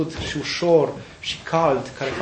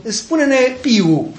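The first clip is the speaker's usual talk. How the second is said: very slow, higher mock teacher asking; teacher quizzing; 8 semitones higher, 4 dB louder, much slower than usual. Teacher testing the class, slow and higher. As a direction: drag out the question like a teacher. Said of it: "ca un profesor care spune".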